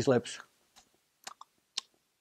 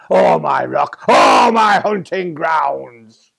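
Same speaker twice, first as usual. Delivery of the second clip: much louder, slow roaring threat; threatening shout; 3 semitones higher, 13 dB louder, much slower than usual. A narrator's reading. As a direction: bellow it to drive him off. Tiger threatens the man.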